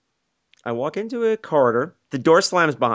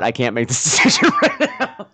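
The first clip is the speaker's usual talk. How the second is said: high voice cracking up into laughter; laughing protest; 9 semitones higher than usual. Retold or imitated. Pinned man claims he can't decide, laughing. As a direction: lose the line to a laugh.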